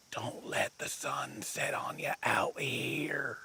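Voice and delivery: whispering. Acted. Ominous warning about Skunk Ape.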